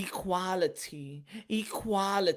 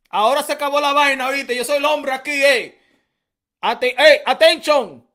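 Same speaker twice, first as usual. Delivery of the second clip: much louder, higher shout; grito fuerte fingido; mock shout; 8 semitones higher, 15 dB louder than usual. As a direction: bellow at the wife. Shouting at woman, Spanish.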